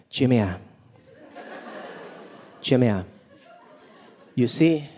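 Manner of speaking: low, halting mom voice with pauses; a hint of a lecturing mom; lightly voiced immigrant mom. Comic's mom consoling her scared son, halting. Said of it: "She was like".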